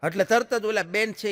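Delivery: normal talking voice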